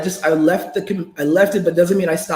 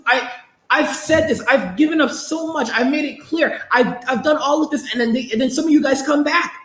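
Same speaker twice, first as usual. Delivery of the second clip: high-pitched, worked-up scolding; exasperated rant; 7 semitones higher than usual. Real, not acted. Streamer telling off viewers who keep coming back, exasperated. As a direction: tell them off, pitched up in exasperation.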